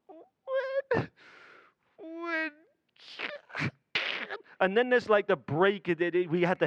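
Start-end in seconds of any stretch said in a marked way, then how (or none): 0.1-3.7 very high, breaking crying voice; high, breaking child's sob; mock sobbing child. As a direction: wail the words like a hysterical kid.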